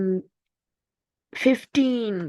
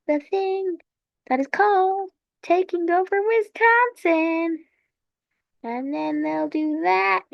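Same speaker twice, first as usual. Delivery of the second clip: higher-pitched storybook narration, building at the end; mock kids' book; 5 semitones higher than usual. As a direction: narrate conquests like a picture book for small children. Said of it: "kids' book".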